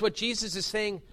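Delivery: ordinary talk, nothing marked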